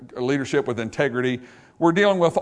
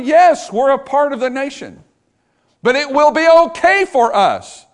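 high and loud, slowing on well; preaching climax; 7 semitones higher, 6 dB louder than usual. Acted. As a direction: build to the peak of the sermon. Climax of the sermon.

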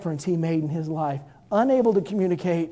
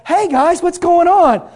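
much higher, louder friendly hello; healed woman greeting; 12 semitones higher, 12 dB louder, slower than usual. Acted. Healed woman greeting the men in the market, high and bright. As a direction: call out gladly to old friends. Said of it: "woman with the issue of blood".

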